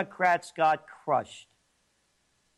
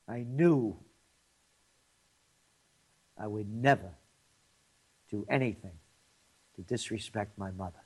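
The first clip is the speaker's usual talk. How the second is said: sincere, fading; 6 semitones lower than usual. Real, not acted. Emotional memory of mother.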